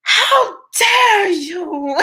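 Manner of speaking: put-on crazier, high, very slow Greta Thunberg accent; wildly hammed-up high Greta Thunberg accent. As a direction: go over the top with a Greta Thunberg accent, then crack up. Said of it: "I got a little crazier with it".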